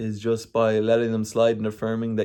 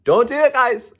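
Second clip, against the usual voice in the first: higher, louder playful warning; emphatic warning; 12 semitones higher, 6 dB louder than usual. Real, not acted. Runner warning viewers against untrained marathons, higher and louder. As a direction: wag a finger at the viewers.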